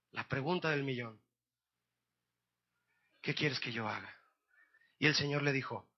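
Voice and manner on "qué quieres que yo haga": low, soft restatement of the question; quiet reflection. Shown as a demonstration. Preacher repeats the million-dollar question, quietly reflective. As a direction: let the question sink in softly.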